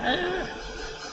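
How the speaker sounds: sharp high gasp; a delighted intake of breath. Real, not acted. Excited gasp at colours.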